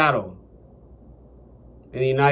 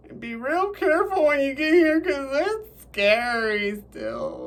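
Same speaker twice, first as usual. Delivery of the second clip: high cautioning voice; 9 semitones higher, 4 dB quieter than usual. Acted. A storyteller's bird warning another to be careful, high singsong caution. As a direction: stretch it out high and soft, sliding down at the end.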